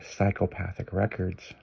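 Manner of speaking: low, hushed, slow receptionist purr; phone sex operator. Retold or imitated. Receptionist answering the record label's phone, like a phone sex operator. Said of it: "Like a phone sex operator".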